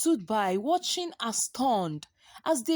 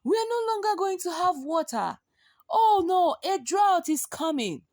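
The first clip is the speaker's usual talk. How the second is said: higher, fast panicked lament; alarmed crowd fearing the drought; panicked villagers; 7 semitones higher than usual. A narrator's reading. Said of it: "they cried".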